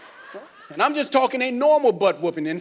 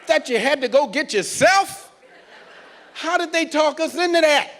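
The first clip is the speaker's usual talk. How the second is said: ordinary talk, nothing marked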